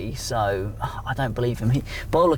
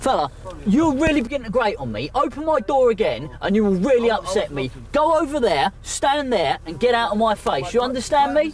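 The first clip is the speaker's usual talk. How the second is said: stern warning; 10 semitones higher than usual. Real, not acted. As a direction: raise the voice and lay down the law. Officer ordering man away.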